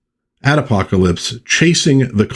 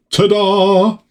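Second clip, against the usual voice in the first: playful ta-da; 12 semitones higher than usual. Pretend. Reveal after transport.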